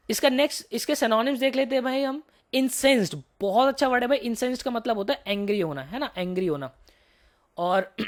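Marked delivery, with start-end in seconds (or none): none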